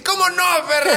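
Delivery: high, cocky retort; cocky comeback. Retold or imitated. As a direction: toss it off like a smug trickster. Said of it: "Este güey dice". (réplica burlona y aguda)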